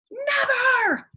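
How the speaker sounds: very high, loud defiant shriek; monster's shout. Acted. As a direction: scream it back in defiance. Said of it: "shouted Medusa".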